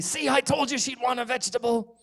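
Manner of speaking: high, loud whiny accusation; blaming kid. Acted. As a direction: point the finger at the others. Kids blaming each other.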